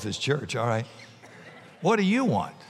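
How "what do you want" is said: ordinary talk, nothing marked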